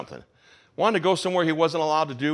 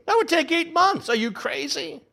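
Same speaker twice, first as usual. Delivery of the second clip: much higher, disbelieving outburst; incredulous disciple; 13 semitones higher than usual. Acted. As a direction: scoff at the impossible cost. Disciples balking at the cost.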